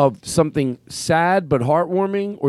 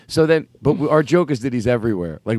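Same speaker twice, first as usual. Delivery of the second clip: ordinary talk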